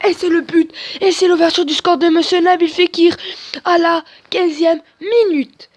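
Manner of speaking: plus haut et fort, finale lente; annonce de commentateur. Acted. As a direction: proclame le but comme à la télé. Annonce son but en commentateur. (voix de commentateur qui annonce le but)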